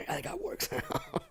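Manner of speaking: high, breathy gasp line; gasping fan. Retold or imitated. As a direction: gasp then boast softly. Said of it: "They're like this".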